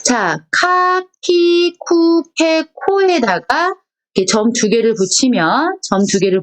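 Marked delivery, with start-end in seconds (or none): 0.0-2.6 카, 키, 쿠, 케 read slowly and high, one by one; 카 키 쿠 케 또박또박; reading kana aloud. Shown as a demonstration. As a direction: spell out 카 키 쿠 케 for students. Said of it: "그게 지금 여기 나와 있습니다".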